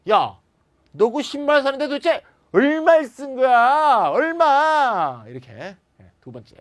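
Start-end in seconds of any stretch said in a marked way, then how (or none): 0.1-5.2 high, loud incredulous question, stretching 얼마; shocked disbelief. Shown as a demonstration. Teacher acting out shock at a friend's spending, high and loud. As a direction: gasp at the price. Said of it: "how much의 격한 감정".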